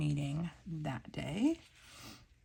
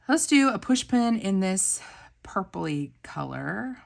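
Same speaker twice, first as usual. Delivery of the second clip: eager pick, higher and louder; excited planning; 4 semitones higher, 10 dB louder than usual. Real, not acted. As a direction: announce the choice with a thrill. Excited choosing of sticker.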